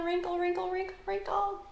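high tiny squeak; squeaky high voice. Acted. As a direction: squeeze the voice up like a raisin. Scrunched raisin face.

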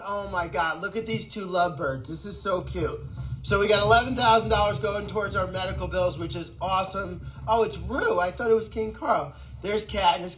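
usual speaking voice

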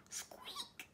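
very high, very soft squeak; tiny squeak. Acted. Mouse answering Duck with a tiny soft squeak. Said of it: "said Mouse".

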